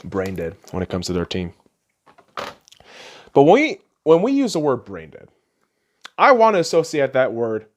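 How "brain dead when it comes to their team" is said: normal talking voice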